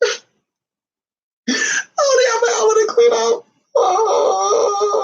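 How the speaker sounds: high-pitched, hammed-up mock wail; fake crying. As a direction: bawl it out in pretend sobs. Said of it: "I'm not really crying".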